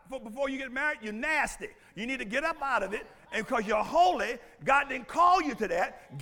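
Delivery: ordinary talk, nothing marked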